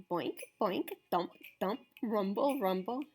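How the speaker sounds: plain reading voice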